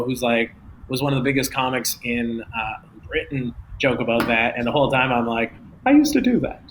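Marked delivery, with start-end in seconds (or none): 5.9-6.6 high mock-excited squeal; squealed confession. Comic hearing his old habit mocked, high self-mocking squeal. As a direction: squeak it high, poking fun at yourself. Mock reaction to own past.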